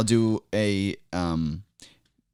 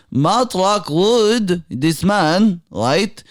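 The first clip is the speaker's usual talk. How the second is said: put-on slow, much higher, prophetic Middle Eastern accent; Middle Eastern accent, preaching; 10 semitones higher, slower than usual. Guest hailing the host as savior in Middle Eastern accent.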